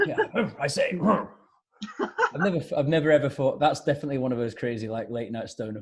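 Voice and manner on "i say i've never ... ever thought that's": higher, mock-English dog's bark; English dog accent. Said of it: "barks in an English accent".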